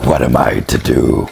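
loud despairing shout; loud comic cry. Acted. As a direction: wail the question. Loud answer by other voice.

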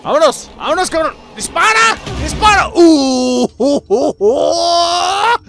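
high, loud frantic screaming; panicked screaming. Real, not acted. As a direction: scream in terror mid-fight. Fighting the hunter in panic.

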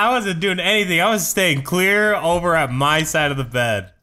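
high, loud, defensive; loud protest. Real, not acted. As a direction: protest it loudly. Defending himself, laughing.

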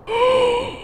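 high, sudden gasp; sharp gasp. Real, not acted. Player watching the plan unfold, sharp gasp. Reacting to the game.

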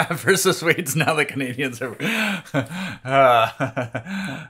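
loud, high laughing talk. Real, not acted. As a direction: laugh through the words. Laughing about Canadians.

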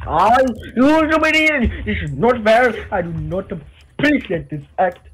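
outraged indignant outburst; shaking with anger. Pretend. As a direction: yell it in mock fury. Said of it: "that was my, um, impression".

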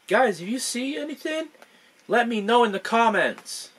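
high, bright Dora-style call to the viewers; chirpy cartoon explorer asking the audience for help. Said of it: "this is gonna look like a Dora the Explorer".

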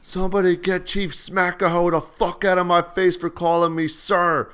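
high, loud instructor's tirade; imitated angry yell. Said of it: "And she yells".

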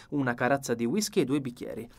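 usual speaking voice

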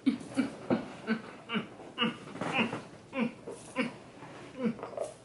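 long, breathy, stuttering laugh; drawn-out laugh. Real, not acted. Acting crazy at the start of the video, long stuttering laugh. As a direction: laugh on and on in little bursts.

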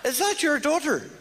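very high, slow astonished question; extremely surprised. Shown as a demonstration. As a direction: gasp it out in disbelief. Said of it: "That's as surprised as you can get".

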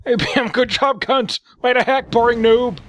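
high-pitched mock gamer meltdown; losing it. Commentator voicing a sore loser's chat, hammed-up rage. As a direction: pile up insults in a high, strained rant. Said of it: "Somebody's losing their shit".

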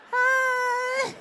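hammed-up embarrassed greeting; awkward hi. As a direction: squeak it out, mortified.

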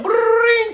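high trilled ring sound; comic high "brrring"; phone ring imitation. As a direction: mimic the phone going off. Imitates the phone ringing again.

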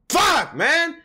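high-pitched frustrated curse; real anger. Real, not acted. Viewer still mad after the scene, angry cursing. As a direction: curse out loud, truly mad. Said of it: "now I'm mad".

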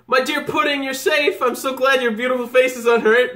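a hint of a lovesick charmer; lovestruck Sanji. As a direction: swoon over her like a smitten suitor. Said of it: "This is Sanji".

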